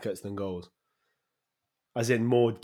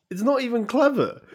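exasperated; 13 semitones higher, 4 dB louder than usual. Real, not acted. Repeats disdain for name.

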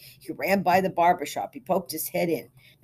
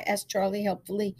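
ordinary delivery

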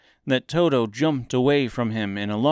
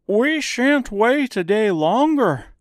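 high, loud, slow scarecrow protest; indignant scarecrow; 13 semitones higher, 6 dB louder than usual. A narrator's reading. Scarecrow refusing to wait, high indignant voice.

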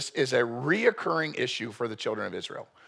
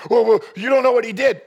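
high, loud, indignant objector's voice; mock protest; 9 semitones higher, 10 dB louder, faster than usual. Imagined churchgoer fires back at the preacher, indignant and loud. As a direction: play the listener bristling back at the preacher.

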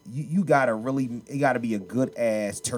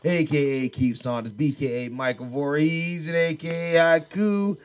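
raised, slow announcer delivery; show-host introduction; 7 semitones higher, 5 dB louder, slower than usual. Real, not acted. Host introducing himself and the episode, slow announcer style. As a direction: announce yourself grandly, stretching the words.

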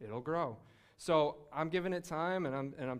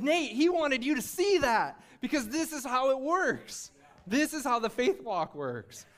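louder, fired-up sermon delivery; preaching at full force; 9 semitones higher, 6 dB louder, slower than usual. Acted. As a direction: preach it, the congregation hanging on every word. Preaching climax to the congregation.

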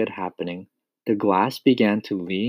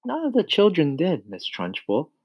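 Miss Honey answering; 6 semitones higher than usual. A narrator's reading. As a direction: answer the headmistress in a higher voice. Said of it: "Miss Honey answered".

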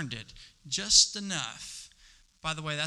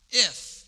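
"If" punched high and loud; stressed if; 6 semitones higher, 6 dB louder than usual. Shown as a demonstration. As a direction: hit "If" hard and high. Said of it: "Have you ever heard that".

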